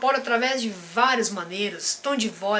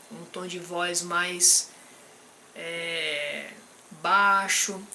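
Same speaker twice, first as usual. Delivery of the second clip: slower and softer, settling down; calm low voice; 5 semitones lower, 4 dB quieter, much slower than usual. Shown as a demonstration. Coach demonstrating the calm voice for a loving partner, slow and soft. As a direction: show the calm tone you'd use with a partner. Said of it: "um tom de voz mais calmo".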